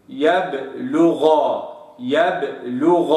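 يبلغ with the still ب bounced, slow; slow Quranic يبلغ, echoing ب; qalqala on baa. Shown as a demonstration. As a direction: say يبلغ letting the ب echo briefly. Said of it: "هكذا".

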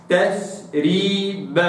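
تثريب drawn out very slowly, clear th; slow recitation. Shown as a demonstration. Teacher reciting the verse word slowly. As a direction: stretch تثريب with a crisp ث. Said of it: "هكذا".

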